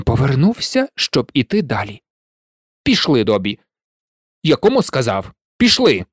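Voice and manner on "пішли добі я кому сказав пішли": low, clipped command rising to a bark; curt order. A narrator's reading. Malfoy ordering Dobby to follow, angry. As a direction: order the servant off, losing patience.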